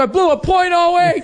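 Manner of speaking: high, whiny mock complaint; mock whiny drunk. Mocking the classmate at the meeting, high and whiny. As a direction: whine it like the guilty guy.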